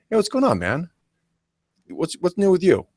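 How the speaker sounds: relaxed natural smile in the voice; smooth natural greeting. Shown as a demonstration. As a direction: greet like an old friend. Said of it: "it's very easy. It's smooth".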